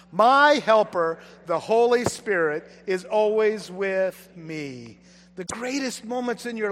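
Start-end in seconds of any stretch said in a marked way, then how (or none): 0.1-5.0 slow, louder unison declaration; recited together. Shown as a demonstration. Pastor leading the church's response: slow, louder recitation. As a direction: proclaim it like a shared creed.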